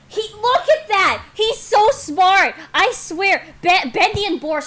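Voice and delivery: loud, high-pitched excited gushing; excited outburst. Real, not acted. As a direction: burst out in real amazement, loud. Excited reaction to the comic.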